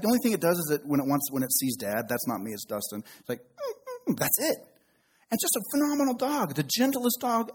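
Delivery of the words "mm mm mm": high, soft, nasal-free whimpering mm like a puppy; imitating the dog's high pleading whine; dog whine imitation. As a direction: whimper like an eager husky.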